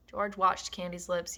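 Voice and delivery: ordinary delivery, nothing marked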